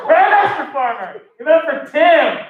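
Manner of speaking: loud high emcee call; host announcement. Real, not acted. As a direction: hype the next act to the room. Host introduces next comic.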